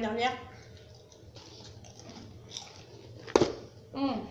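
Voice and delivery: eating a snack while speaking; talking while chewing. Real, not acted. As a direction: mouth partly full of chip. Seen 2: Chip to mouth at 0.4, chewing-like mouth movements through later frames.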